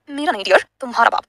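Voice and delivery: plain reading voice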